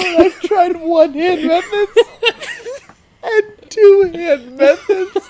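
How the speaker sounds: high, loud and slow, breaking into squeals of laughter; laughing till crying. Real, not acted. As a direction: read "I've tried one hand methods and two hand methods" while laughing too hard to speak. Rereading question while laughing hard.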